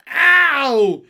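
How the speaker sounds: very high, very loud yelp; long, loud "Ow"; pained scream. A narrator's reading. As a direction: scream it as if just hurt. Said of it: "he screamed".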